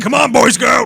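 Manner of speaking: high, loud taunt; mock taunting shout. Friend imagining him taunting the brawler, loud mocking shout. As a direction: taunt him at full volume. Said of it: "You'd be like".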